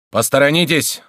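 officer ordering the crowd aside; commanding shout. A narrator's reading. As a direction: bark it at the crowd. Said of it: "вскричал повелительно офицер".

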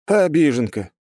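high-pitched mocking jab; smirking tease. A narrator's reading. Hero mocks the offended god, smirking. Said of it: "усмехнулся я".

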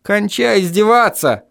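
high, exasperated protest; high-pitched yell at modest volume; mock yell. A narrator's reading. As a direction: cry out to stop the teasing. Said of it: "завопил я".

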